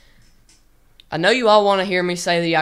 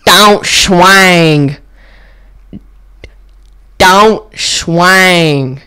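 much louder, stretched out yell; iconic old line; 14 dB louder, much slower than usual. Retold or imitated. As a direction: belt the old catchphrase with full force. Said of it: "prepare your ears for this".

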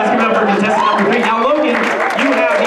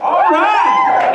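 excited cheer; 12 semitones higher than usual. Real, not acted. Host cheers Nevada answer.

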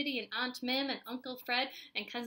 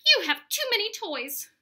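loud, high-pitched scolding; yelling; 9 semitones higher, 10 dB louder than usual. A narrator's reading. Mom fed up with all the toys, yelling at Spencer. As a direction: raise your voice in exasperation. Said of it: "she yelled on her way upstairs".

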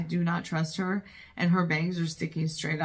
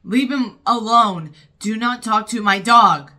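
loud, emphatic scolding; protective anger; 2 semitones higher, 11 dB louder than usual. Real, not acted. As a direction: warn them off your dog, very loudly. Yelling at film character over dog.

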